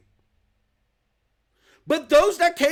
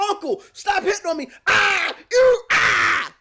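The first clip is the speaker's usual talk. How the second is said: high mock cry of surrender; mock screaming; 5 semitones higher, 4 dB louder than usual. Imitating people begging to be left alone, mock screaming. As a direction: yelp it out, imitating someone taking a beating. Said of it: "same people that are screaming".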